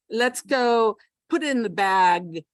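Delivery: slow and loud, every final consonant popped; exaggerated final consonants. Shown as a demonstration. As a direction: hit each word's ending hard, slowly. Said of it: "Over exaggerate the final consonants".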